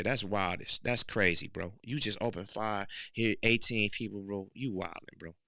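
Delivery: normal talking voice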